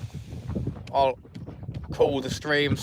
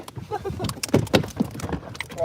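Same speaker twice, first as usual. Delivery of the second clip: breathy high laugh. Real, not acted. After landing fish.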